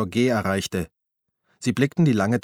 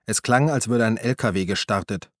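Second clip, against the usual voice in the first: usual speaking voice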